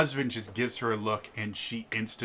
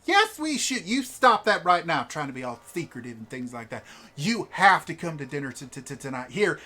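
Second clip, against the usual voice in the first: high, loud overriding; bossy noblewoman; 5 semitones higher, 6 dB louder than usual. Acted. A wife overruling her husband to invite the players, high and loud. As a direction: overrule your husband cheerfully.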